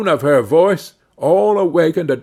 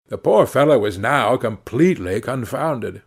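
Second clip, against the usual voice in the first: ordinary delivery, nothing marked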